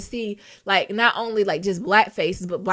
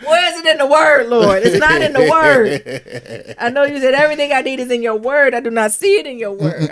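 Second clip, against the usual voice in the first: mock prayer lament; 4 semitones higher, 8 dB louder than usual. Mock complaint to the Lord.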